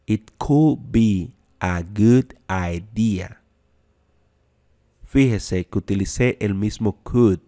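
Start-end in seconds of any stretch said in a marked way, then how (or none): 0.1-3.4 very slow, each word clear; slow, deliberate teacher model; slow demo. Shown as a demonstration. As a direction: spell it out slowly for the class.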